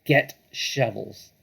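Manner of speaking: slow blunt order. Acted. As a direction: lay down the order. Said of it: "said Bean".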